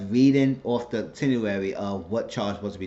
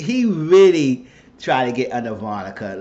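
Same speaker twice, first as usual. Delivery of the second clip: normal talking voice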